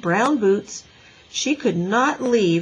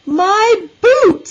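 high, very loud cry of dismay; wailing; 13 semitones higher, 11 dB louder than usual. A narrator's reading. Little girl tells teacher of lost boots, wailing. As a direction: wail it like a distraught child.

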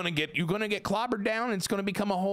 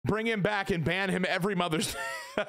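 normal talking voice

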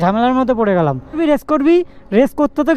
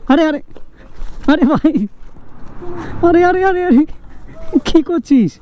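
alarmed calling out; 4 semitones higher than usual. Real, not acted. Alarm at friends' scuffle on road.

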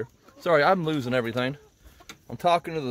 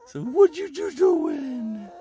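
cooing to birds; 13 semitones higher, much slower than usual. Real, not acted. Talking sweetly to her quail.

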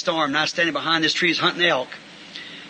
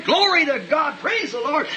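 higher shouted praise; retold holler; 9 semitones higher, slower than usual. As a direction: holler it round the tree in joy. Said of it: "I went around the tree hollering".

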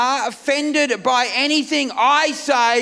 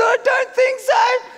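much higher and slow, a guilty squeak; squeaky denial; 12 semitones higher, slower than usual. Retold or imitated. Sick husband insisting to his wife he hasn't got covid, high and slow. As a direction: stretch it out in a high whiny squeak. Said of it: "I said".

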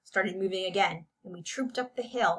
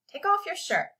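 high, insistent command; bossy demand in a raised voice; ordering; 11 semitones higher than usual. A narrator's reading. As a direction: order it, pitch leaping up. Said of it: "ordered Jeremy".

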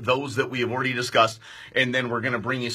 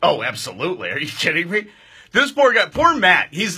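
normal talking voice

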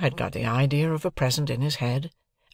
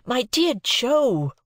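put-on a desperate boy's plea, slow and higher; desperate cry; 12 semitones higher, 4 dB louder, much slower than usual. A narrator's reading. Pip clutching Joe's coat, crying out in desperation. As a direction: plead like a boy at his wits' end. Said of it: "I cried in desperation".

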